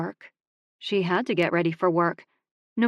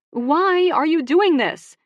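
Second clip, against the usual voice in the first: much higher, louder and slow, demanding; exasperated outburst; 10 semitones higher, 8 dB louder, slower than usual. Acted. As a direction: throw the question at him in frustration. Sister exasperated, throws out hands.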